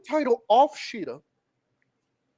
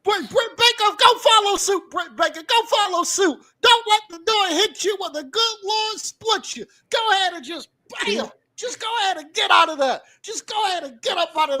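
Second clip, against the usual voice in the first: higher, louder mock shout; mocking send-off; 8 semitones higher, 4 dB louder than usual. Host telling Britt Baker to leave too, loud mocking send-off. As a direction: shoo her out the door.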